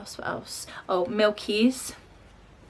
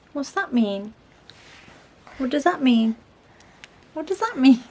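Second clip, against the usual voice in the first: higher, louder coaxing voice; 7 semitones higher, 9 dB louder than usual. Real, not acted. Mum coaxing her toddler to speak, child-directed voice.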